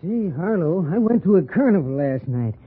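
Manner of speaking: ordinary talk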